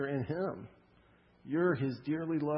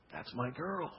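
soft, tender claim; hushed declaration; 8 dB quieter than usual. Acted. God claiming a daughter, spoken softly. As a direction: say it gently, well below talking volume. God's shout softly voiced over congregation.

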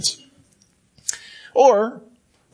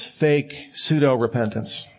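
ordinary talk

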